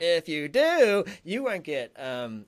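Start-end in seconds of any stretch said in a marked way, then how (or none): 0.0-1.0 slow, loud radio-host shout; Good Morning Vietnam voice. Retold or imitated. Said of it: "Good Morning Vietnam thing".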